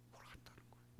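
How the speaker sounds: whispered aside. Real, not acted.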